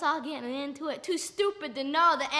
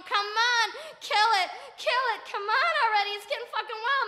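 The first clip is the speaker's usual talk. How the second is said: raised, high-pitched impatient shouting; urging on; 4 semitones higher, 3 dB louder than usual. Acted. Onlooker urges his friend to finish the cat, urgent shouting. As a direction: egg him on loudly and urgently. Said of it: "and I'm going".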